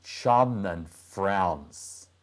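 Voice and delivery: ordinary delivery, nothing marked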